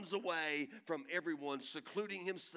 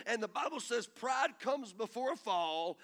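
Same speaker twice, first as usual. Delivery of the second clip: ordinary talk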